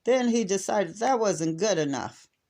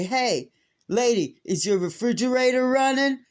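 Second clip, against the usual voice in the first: prank caller voice; 7 semitones higher, 4 dB louder than usual. Retold or imitated. Prank call voiced in story.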